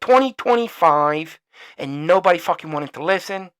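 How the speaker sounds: loud, heated rant with swearing; furious venting at the politicians; angry rant. Real, not acted. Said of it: "twenty-minute rant".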